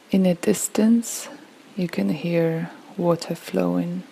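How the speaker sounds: unhurried relaxation voice, phrase by phrase; guided meditation. Acted. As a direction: gently guide a listener through a peaceful visualization. Leads a guided visualization.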